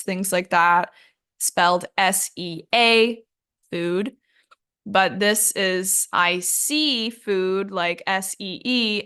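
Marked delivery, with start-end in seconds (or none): 6.1-7.7 slow, with "see" drawn out; stress on see. Shown as a demonstration. Teacher explaining the seafood pun, stressing "see" slowly. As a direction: stretch out the word "see" for clarity.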